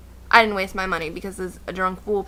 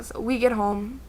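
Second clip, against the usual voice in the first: usual speaking voice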